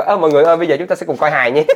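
loud, high, cheery emcee call; hammed-up cheerful announcement; bubbly host voice. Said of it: "xong rồi kêu".

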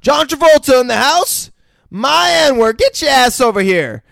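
loud, high hammed-up boss greeting; Marcellus greeting. Friend voicing Marcellus calling John over, loud and high. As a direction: boom a big mock welcome across the room. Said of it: "we hear Marcellus' voice say".

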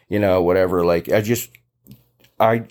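ordinary talk

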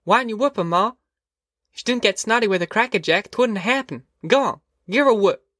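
put-on high, pestering little boy voice; a boy egging Ma on to punish his sister; whiny little boy. Boy Winfeld urges punishment.

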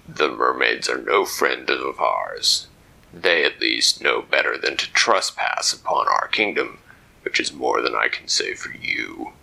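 a stern armored sentry; deep lobster guard. Acted. Lobster guard stopping the intruder, low stern voice.

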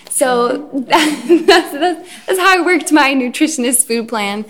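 words bubbling with real laughter, pitch up; laughing through it. Real, not acted. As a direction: laugh while confessing the trick. Laughing at her own rationalizing.

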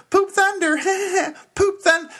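put-on very high, loud little kid voice; high-pitched kid shouting with giggles; four-year-old voice. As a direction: squeal it like an excited toddler. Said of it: "imagine my four-year-old kind of going".

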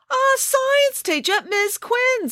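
put-on very high, slow girl voice; exasperated girl. A narrator's reading. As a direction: spell it out impatiently. Daughter exasperated.

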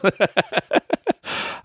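high breathy laugh; airy laugh. Real, not acted. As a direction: let out a quick giggle. Laugh about paying son.

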